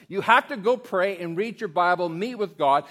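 loud, pressing instruction; emphatic preaching. Real, not acted. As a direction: drive home the rule. Pastor repeating his advice.

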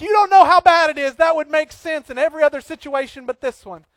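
loud, high, exasperated cry; mock upset venting. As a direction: protest like a friend who won't be advised. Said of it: "you're like".

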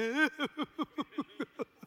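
high, pulsing comic sob; exaggerated blubbering cry; mock weeping wail. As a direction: wail tearfully in a hammed-up way. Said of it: "I'm worse than the Harry Potter lady".

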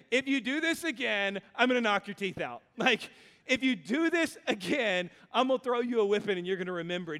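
hammed-up angry warning, rushing at the end; playful tirade gathering speed; mock threat. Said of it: "He's literally saying".

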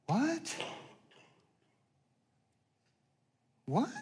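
soft, high, rising question; shocked disbelief. Acted. Preacher reacts to the shocking answer, quietly incredulous. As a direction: let it leap up, stunned.

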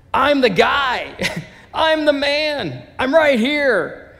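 high, loud, drawn-out calling out; insistent shout. Acted. Healed beggar to doubting neighbors, acted insistent shout. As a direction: shout each claim slowly to convince the crowd.

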